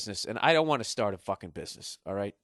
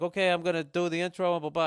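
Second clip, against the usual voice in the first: put-on failed Icelandic accent; 6 semitones higher, 4 dB louder than usual. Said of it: "I can't even do the accent".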